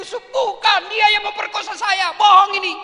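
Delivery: high, loud denial; high shouted protest; indignant shout. Acted. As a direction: yell out the denial. Yusuf protests innocence.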